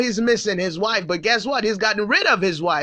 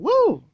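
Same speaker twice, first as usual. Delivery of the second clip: high whoop; 13 semitones higher, 5 dB louder than usual. Real, not acted. Exclaims at king's demand.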